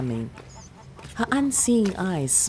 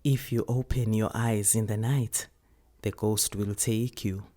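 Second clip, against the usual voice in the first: lower, softer spooky warning; hushed grandmother warning; 4 semitones lower, 4 dB quieter than usual. Acted. Grandmother warning the child about the ghost. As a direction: warn her in a low hush.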